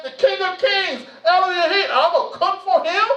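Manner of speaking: half-laughing disbelief at the honor; awed excitement. Retold or imitated. Tells the church he'll cook for the King, laughing in awe.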